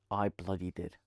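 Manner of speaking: ordinary talk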